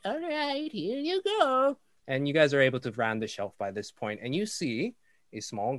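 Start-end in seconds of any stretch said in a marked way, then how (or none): 0.0-1.8 put-on high, slow old woman handing over; slow down, frail old shopkeeper; old lady voice. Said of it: "she's like".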